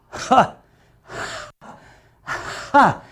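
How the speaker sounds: two high airy "ha" puffs; a hard aspirated ha ha; breathy ha demo. Shown as a demonstration. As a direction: push out a light, high breathy ha. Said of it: "this actually goes".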